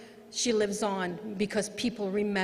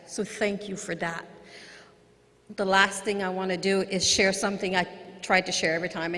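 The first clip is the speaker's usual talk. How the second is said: ordinary talk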